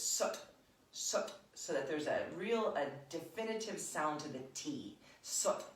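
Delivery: "sut" punched hard with a crisp T; emphatic "sut", definite T sound. Shown as a demonstration. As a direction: hit "sut" with force from the navel. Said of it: "you say it with emphasis".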